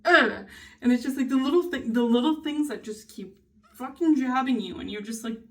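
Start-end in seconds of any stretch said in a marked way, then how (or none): none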